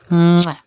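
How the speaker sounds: loud, high kiss noise; exaggerated "mwah" kiss; blown kiss.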